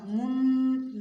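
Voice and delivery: من held long and hummed through the nose; nasal ikhfa. Shown as a demonstration. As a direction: send the n of من into the nose and linger. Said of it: "ناک میں آواز جائے گی".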